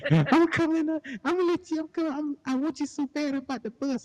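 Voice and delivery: very high, excited man; high-pitched man voice. Acted. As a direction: squeal it in a high voice. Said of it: "Do I gotta do both voices?".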